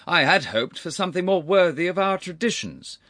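high, loud disapproval; touchy temper. A narrator's reading. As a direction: voice your displeasure forcefully. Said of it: "He allowed himself to show it".

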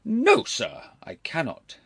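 loud, high, sharp refusal. A narrator's reading. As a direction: cut him off sharply. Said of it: "his uncle answered sharply".

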